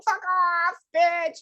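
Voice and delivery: high childish mock curse; mock kid swearing. Animal psychic imagining kids cursing at parents. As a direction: play a kid spitting out a swear.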